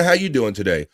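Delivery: ordinary talk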